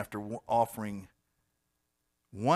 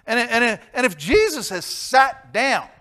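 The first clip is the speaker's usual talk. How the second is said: loud, high, slow proclamation; preaching shout; 13 semitones higher, 16 dB louder, slower than usual. Acted. Preacher proclaiming Jesus sat down, loud and high. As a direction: lift it to a ringing climax.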